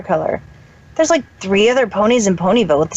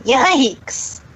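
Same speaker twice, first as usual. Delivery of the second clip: a sharp, higher startled yelp; startled exclamation; 6 semitones higher than usual. Acted. Spike startled, crawling out from his hiding spot. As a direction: jump at the fright and blurt it out. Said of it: "Exclaimed Spike".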